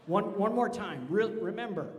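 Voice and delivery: loud call to the crowd. Real, not acted. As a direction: raise your voice to be heard across the hall. Conductor calling out to crowd.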